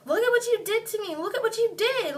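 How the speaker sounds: high, loud, fast accusing cry; upset screaming. Retold or imitated. Injured teller to drunk friend who hurt her, retold upset cry. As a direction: re-enact a distraught accusation. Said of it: "I was screaming and crying".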